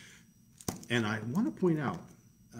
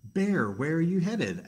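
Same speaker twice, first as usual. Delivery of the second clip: higher, louder eager fox voice; a bright-eyed fox calling to Bear; 7 semitones higher, 6 dB louder than usual. Acted.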